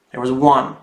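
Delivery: ordinary delivery, nothing marked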